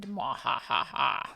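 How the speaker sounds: slow low evil laugh; mad scientist cackle. Pretend. Chat called demented.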